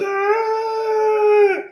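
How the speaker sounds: sudden high-pitched cartoon yelp; a short squealing cry, imitating Kenny; Kenny's shriek. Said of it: "and Kenny goes".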